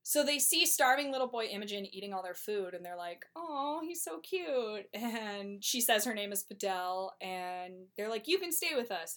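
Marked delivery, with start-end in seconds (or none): 3.3-4.9 put-on high, slow cooing over a cute boy; a coo of mock adoration. Brothers cooing over the starving little boy. 8.3-9.2 high, eager and friendly; a bright invitation, pitched up; eager welcome. Retold or imitated. Brothers inviting the little boy to stay.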